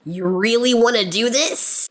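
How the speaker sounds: imitated guy clapping back. Dude answering an online attack, imitated low challenge. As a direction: put on a low, slow challenging voice. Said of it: "No, that's not how he would sound".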